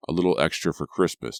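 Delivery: ordinary delivery, nothing marked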